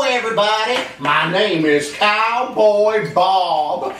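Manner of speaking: high, folksy Texas cowboy, chuckling; hammed-up cheerful cowboy telling jokes; Cowboy Bob. Puppet cowboy character throughout.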